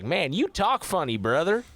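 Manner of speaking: put-on Alabama accent. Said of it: "you go down to Alabama".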